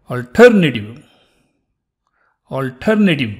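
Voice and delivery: alternative with a strong TER and swallowed final vowel; last E almost dropped. Shown as a demonstration. Teacher modelling the word, alternative with last E almost dropped. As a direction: nearly drop the last E of alternative. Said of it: "The last E is sort of almost dropped".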